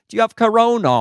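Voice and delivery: put-on high London girl's question; London accent. London girl asking the comedian, high-pitched London accent. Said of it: "I love the London accent".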